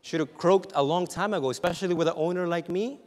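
car owner marvelling to the Lord, loud; grateful prayer. Retold or imitated. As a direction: marvel at God keeping the car alive. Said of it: "I'm thinking".

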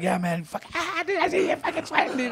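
teasing Mark. Retold or imitated. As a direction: needle him for sweating, squeaky and amused. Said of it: "he's like".

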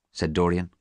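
usual speaking voice

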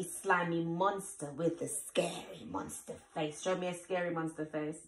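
plain reading voice

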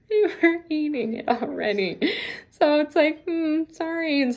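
high giggly embarrassed sorry; laughing. Real, not acted. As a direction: laugh through the sheepish sorry.

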